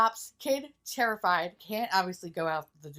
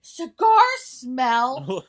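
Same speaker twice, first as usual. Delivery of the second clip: very slow, much louder drawn-out words; slow sniffing; 6 semitones higher, 12 dB louder, much slower than usual. Retold or imitated. Kid opening microwave, slow loud sniff. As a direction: savor each word like a strange smell.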